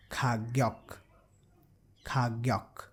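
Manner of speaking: Khagyok, K, G, then final K; Korean word Khagyok. Shown as a demonstration. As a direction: say Khagyok, G in the middle. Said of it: "will be pronounced as K".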